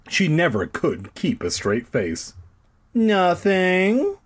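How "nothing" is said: lying innocently. A narrator's reading. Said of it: "she lied".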